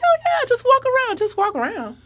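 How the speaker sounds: lightly mocking high casual voice; breezy wave-through tone; casual staffer imitation. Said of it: "And they're like".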